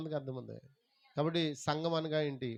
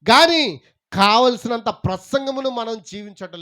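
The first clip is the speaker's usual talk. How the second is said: loud, higher, slow preaching; forceful preaching; 6 semitones higher, 13 dB louder, slower than usual. Acted. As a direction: drive it home slowly to the congregation. (గట్టిగా, నిదానంగా ప్రసంగిస్తూ)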